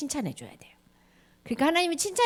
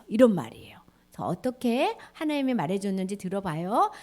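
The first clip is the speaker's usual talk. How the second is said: whisper; 3 semitones lower than usual. Real, not acted. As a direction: keep it a secret whisper. Storyteller keeps whisper.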